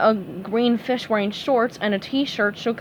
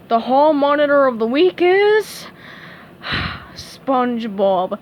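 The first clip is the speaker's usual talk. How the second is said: reluctant; 3 semitones higher, 4 dB louder than usual. A narrator's reading. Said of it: "she gulped".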